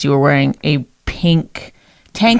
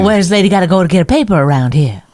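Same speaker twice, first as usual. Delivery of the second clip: put-on fast forties-lady Mid-Atlantic accent; clipped forties film-lady Mid-Atlantic accent; faster than usual. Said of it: "That's the Mid-Atlantic accent".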